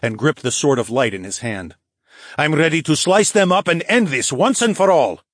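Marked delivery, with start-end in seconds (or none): 2.4-5.2 fierce, raised, ready to fight; determined huff. Acted. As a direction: spit it out, sword in hand. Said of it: "Nike huffed".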